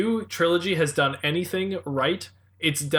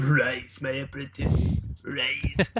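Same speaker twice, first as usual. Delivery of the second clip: cold rasping evil emperor; 3 semitones lower, 3 dB quieter, much slower than usual. Pretend. Quoting the evil emperor from Star Wars.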